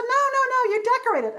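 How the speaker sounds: high-pitched, loud, exasperated correction; hurried calling back. A narrator's reading. Gafsi correcting the Moomins as she runs off, hurried. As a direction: toss it back loudly on the run, way up high.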